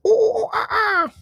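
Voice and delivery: very high, loud monkey hoots; monkey noises. Pretend. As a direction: hoot like a playful monkey. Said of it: "act like some monkeys".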